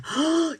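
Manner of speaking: sharp amazed gasp, high. Real, not acted. Gasps at a viewer's story in sudden amazement.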